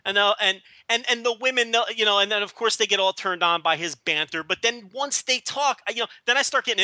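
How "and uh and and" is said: normal talking voice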